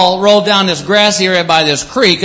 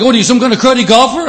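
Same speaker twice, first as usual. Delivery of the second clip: fast, high mock yell; hurried, raised-pitch indignation; retold angry scream; 6 semitones higher, faster than usual. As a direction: imitate a furious rant, not at full volume. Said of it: "screaming at the top of his lungs".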